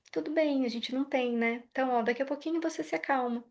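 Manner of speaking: baixinho e tranquilo, sem se abalar; mãe calma. Shown as a demonstration. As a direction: fale bem mais baixo, calma diante do choro. Mãe calma após a birra. (mãe respondendo à birra com voz baixa e serena)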